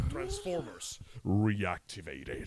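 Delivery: low, slow announcer imitation; trailer title voice. Host describing a game trailer's title reveal, mock announcer voice. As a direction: drop low and stretch each word out.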